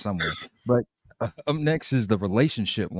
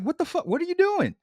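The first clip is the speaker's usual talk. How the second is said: very high startled squeal, much higher; shocked squeal; faster than usual. Retold or imitated. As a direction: shriek in shock, voice jumping way up. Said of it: "the dude's like".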